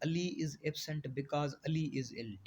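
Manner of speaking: very slow, word-by-word reading of the definition; slow dictation. Shown as a demonstration. Teacher dictating a definition to the class, very slow and even. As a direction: spell the definition out slowly for students to copy. Dictates example sentence slowly.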